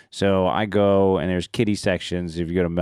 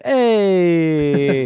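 long, high, loud Italian greeting; hacky Italian accent; 9 semitones higher, 7 dB louder than usual. Retold or imitated. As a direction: belt it out in a hammed-up Italian accent. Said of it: "on this Italian accent that he's doing".

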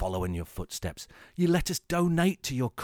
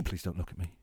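shy murmur; 5 semitones lower, 7 dB quieter, faster than usual. Pretend. Extrovert vs introvert act.

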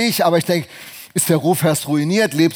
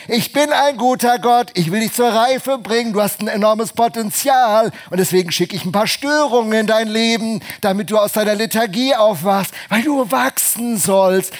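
higher, louder firm God voice; 6 semitones higher, 4 dB louder than usual. Acted. Preacher voicing God answering the spoiled believer, high and firm. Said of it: "Und Gott sagt".

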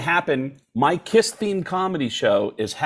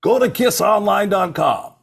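high, loud mock commercial read; mock ad pitch; 8 semitones higher, 4 dB louder than usual. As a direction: sell it like a cheesy ad. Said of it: "ad plug".

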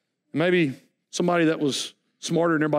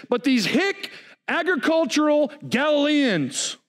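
fiery preaching; 6 semitones higher than usual. Acted. Preaching at full force.

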